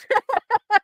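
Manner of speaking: high bursting giggle at herself. Real, not acted. Laughing at her own failed man voice, high giggle. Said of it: "laughing at failed man voice".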